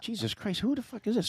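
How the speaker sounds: high, hushed baffled aside; high hushed disbelief; mock surprise. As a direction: wonder aloud who's talking. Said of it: "I'm like".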